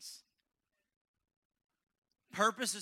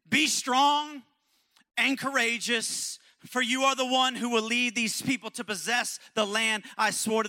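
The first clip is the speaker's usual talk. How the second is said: loud, proclaiming Bible quote; full-force scripture preaching; 5 semitones higher, 10 dB louder than usual. Acted. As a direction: proclaim it to the whole church.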